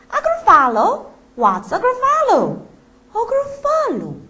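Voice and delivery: high, loud startled snake. Acted. The snake hearing of the Gruffalo, startled. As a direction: gasp out the name in alarm.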